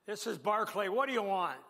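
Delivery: lightly hammed-up challenge to the caller; mock brusque. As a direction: act out answering a suspected prank call. Gruff answer to suspected prank call.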